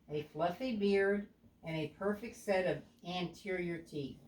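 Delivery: ordinary delivery, nothing marked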